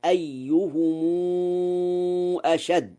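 أيهم stretched to ayyuhumuu before أشد; lengthened plural mim. Shown as a demonstration. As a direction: hold a long "uu" after the mim of أيهم. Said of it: "وله صلة ميم الجمع".

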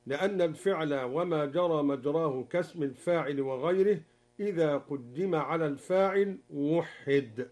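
ordinary talk, nothing marked

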